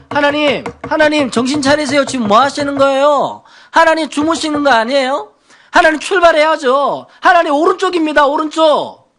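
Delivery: high, insistent mock nagging at God; exasperated complaint. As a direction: boss God around like an impatient backseat driver. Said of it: "그래서 하나님께 원망하죠".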